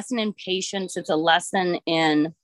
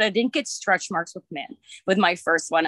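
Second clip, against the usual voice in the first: usual speaking voice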